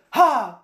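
loud, sharp combat "ha"; sudden high, loud strike yell; mock attack cry. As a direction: imitate a fighter's lunge. Imitates fatal strike.

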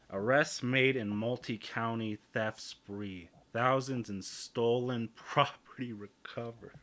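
slow, stretched-out headline delivery; mock news read. Podcaster retrying the news headline, slow mock anchor delivery. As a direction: announce it slowly like a hammed-up newscaster.

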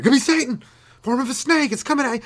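high, panicked mock yell. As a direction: freak out at a snake. Scared people see a snake.

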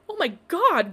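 high, loud alarmed shout. A narrator's reading. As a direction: cry out in shock at the friend on the floor. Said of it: "Glory shouted".

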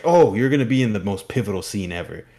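loud, overeager reassurance; placating gush. Retold or imitated. Sweating director placates the neglected model, loud placating gush. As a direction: gush to smooth her over.

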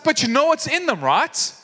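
acted, mild dismay; upset chef. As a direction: imitate an exasperated chef checking with kids. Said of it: "just getting so upset".

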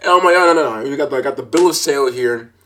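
ordinary talk, nothing marked

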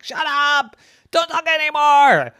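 storybook shout of shut up; mock angry yell. A narrator's reading. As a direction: yell it like an annoyed big brother. Angry big brother voice.

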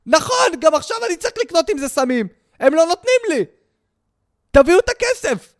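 high, fast, put-on voice demanding cash; mock junkie voice. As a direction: play a desperate addict begging for his money. (מכור מתחנן לכסף, בקול גבוה ומעושה)

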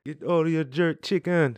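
put-on laughing, slow Jamaican accent. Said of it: "talk in a Jamaican accent".

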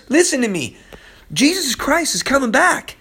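ordinary delivery

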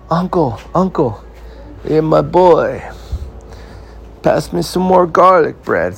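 high, slow, hammed-up Italian boss voice; a fat boss bee ordering his servants, hammed-up Italian mobster; Italian mob boss. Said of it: "like a mob boss".

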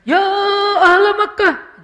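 high, loud, drawn-out shout; preacher's yelled call. Retold or imitated. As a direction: mimic an overblown preacher bellowing to a crowd. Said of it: "berubah nadanya".

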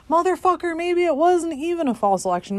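high, loud, exasperated outburst; real frustrated shout. Real, not acted. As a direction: blow up at the thought. Exasperated outburst mid-rant.

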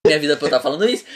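normal talking voice

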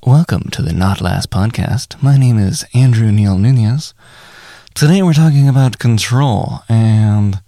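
mock NPR host intro. Shown as a demonstration. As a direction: play a calm public-radio presenter. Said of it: "N P R voiced, take one".

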